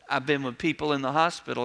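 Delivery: normal talking voice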